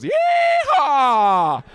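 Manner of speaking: loud, high cowboy holler; wild yeehaw. Retold or imitated. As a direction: let rip a long cowboy whoop. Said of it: "And John goes".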